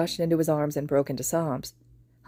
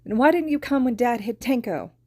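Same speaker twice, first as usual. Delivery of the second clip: angry and tearful; 7 semitones higher, 3 dB louder than usual. Acted. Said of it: "voice wobbling on the edge of anger and tears".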